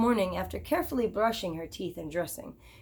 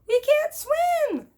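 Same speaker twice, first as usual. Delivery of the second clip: very high, slow squeaky mouse cry; high mouse chorus pleading; chorus of mice; 6 dB louder, much slower than usual. Acted. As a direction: squeak it out like tiny panicked mice. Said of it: "they chorused".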